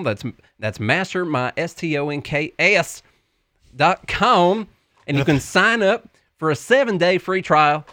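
folksy Southern pitchman; Tennessee accent. Pretend. Co-host pitches the stock market class, mock Tennessee accent. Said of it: "all the videos on the website are in the same voice".

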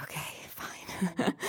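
whispered, reluctant giving in; grudging okay. Retold or imitated. Teen daughter sulkily giving in to her mom. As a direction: give in sulkily under your breath. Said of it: "So I'm like".

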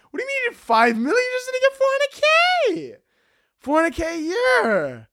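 very high-pitched incredulous squeal; hammed-up disbelief, voice way up high; mock outrage.

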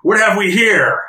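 big monster boss, voice lifted high; growling, booming Minotaur. Acted. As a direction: boom it out like a megaphone. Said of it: "you hear almost this growl in his voice".

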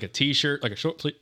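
ordinary delivery

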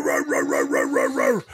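very low, growled wordless grumbling; imitated angry ranting. Men kicking the door in, imitated angry roaring. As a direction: growl out mock shouting as gibberish.

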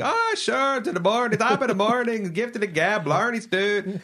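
lilting leprechaun-style Irish accent, mocking the film. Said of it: "he's just got the Irish accent".

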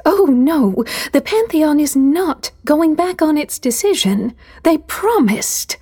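a frightened mother insisting; panicked. A narrator's reading. Sylph panics.

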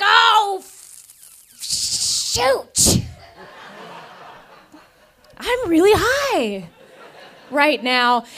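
high gasping alarm, then slowed; sudden panicked realization. Retold or imitated. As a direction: realize in a panic you're stoned. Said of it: "I was like".

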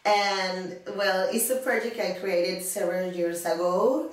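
ordinary delivery

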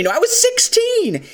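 high-pitched, indignant cry; indignant protest. Retold or imitated. Podcaster voicing Jyn protesting to Saw, high indignant cry. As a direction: squeak it out in outraged disbelief. Jyn answering Saw's accusation.

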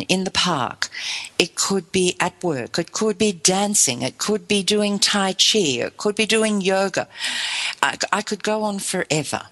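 usual speaking voice

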